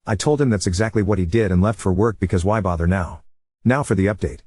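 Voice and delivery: ordinary delivery, nothing marked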